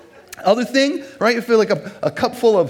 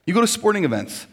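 ordinary talk

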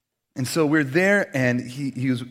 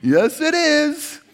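high, slow, polite reply; hammed-up polite neighbor; mock small-talk reply; 11 semitones higher, 6 dB louder, much slower than usual. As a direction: drag out a dull answer. Surface-level small talk.

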